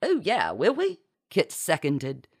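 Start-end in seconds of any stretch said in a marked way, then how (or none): 0.0-1.0 high, swooping, keen question; eager seconding. A narrator's reading. Kit seconds the Duchess's question, eagerly. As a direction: chime in hopefully.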